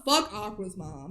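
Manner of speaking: ordinary delivery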